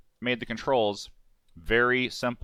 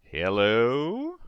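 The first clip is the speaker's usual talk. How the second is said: mic check; 5 dB louder, slower than usual. Real, not acted. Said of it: "How's this audio sounding?".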